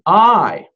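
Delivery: "I" loud, drawn out and weighty; emphatic I. Shown as a demonstration. Preacher showing the congregation an emphatic way of saying I, emphatic. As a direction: land hard on "I". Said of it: "Anochi is emphatic".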